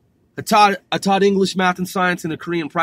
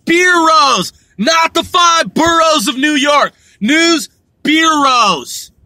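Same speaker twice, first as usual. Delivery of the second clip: emphatic shout; 6 semitones higher, 4 dB louder, slower than usual. Real, not acted. Correcting his own word stumble.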